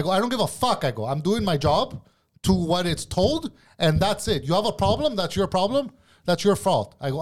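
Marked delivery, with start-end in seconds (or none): 0.1-0.8 high-pitched, heated dismissal; defiant outburst. Retold or imitated. Retelling his quarrel with the boss over food quality, defiant. 1.1-6.9 high, emphatic, indignant retort; heated self-defence. Retold or imitated. Retelling how he told the boss off about his job, heated and emphatic.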